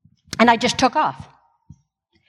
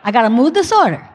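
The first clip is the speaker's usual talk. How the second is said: a hint of a mock Italian accent, tough-guy swagger; lightly put-on Italian, shrugging it off. Patient repeating her diagnosis in mock Italian. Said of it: "I'm not even Italian".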